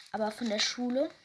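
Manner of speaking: plain reading voice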